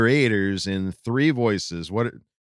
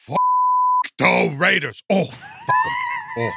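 hammed-up, raised catchphrase voice; classic character voice. Host does the classic third voice, hammed-up. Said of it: "And then a, a classic".

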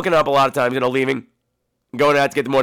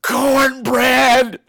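mock sleep-talker yelling, high and drawn out; imitated sleep-talk outburst; sleep-talking shout; 9 semitones higher, 4 dB louder than usual. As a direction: blurt it like someone yelling in their sleep. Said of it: "looks me in the face and goes".

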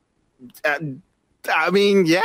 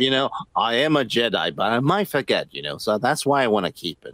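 mock Obi-Wan; 8 semitones lower than usual. Co-host mocking Obi-Wan's logic in a lowered voice. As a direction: drop the pitch, play the solemn Jedi.

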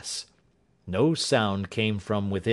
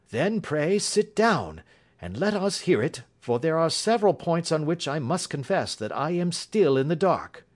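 Holmes, inviting; 7 semitones higher than usual. Acted. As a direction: invite the witness to explain. Voices Holmes in story.

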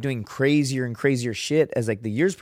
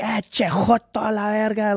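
high-pitched Spanish taunt from a buddy; mock your pal in high Spanish; jeering friends; 7 semitones higher than usual. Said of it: "my friends in Spanish are like".

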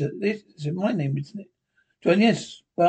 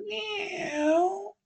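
much higher, slow drawn-out meow; cat meow; 5 dB quieter than usual. Acted. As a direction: mew like a cat. Linda now a cat.

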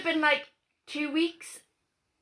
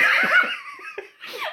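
high laugh; 6 dB louder than usual. Real, not acted. Laughing at childhood memory.

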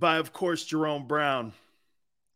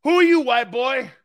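mock challenge; 6 semitones higher, 7 dB louder than usual. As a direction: bark it like a teammate's challenge. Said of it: "I used to drop that like this".

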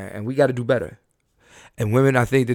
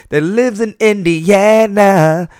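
high, slow, breathy [name] falsetto, loud; [name] impression; 9 semitones higher, 10 dB louder, much slower than usual. Host jokingly announcing the Indianapolis show, as [name]. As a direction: glide up into a loud airy pop-star croon. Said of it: "That's my [name] impression".

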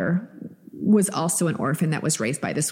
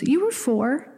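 much higher, childlike imitation of her son; little son's voice; 7 semitones higher, slower than usual. Mom retelling her son's words to podcast cohost, in a high childlike voice. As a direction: pitch way up to play her little boy. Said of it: "He goes".

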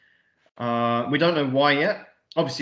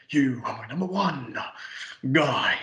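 exaggerated impression. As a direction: ham it up, stretching "You are my number one... guy". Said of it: "he does really exaggerated to Bob".

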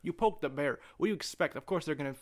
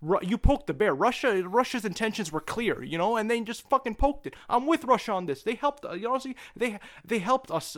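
climbing higher and louder with each line; worked-up rant; 6 semitones higher, 5 dB louder than usual. Real, not acted. Arguing politics with a friend, pitch climbing, fired up. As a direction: let the pitch run away as you get fired up.

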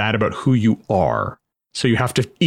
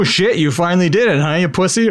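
mock bully taunt; 6 semitones higher than usual. Bully taunting at water park.

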